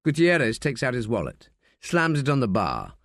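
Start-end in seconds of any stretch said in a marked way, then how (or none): none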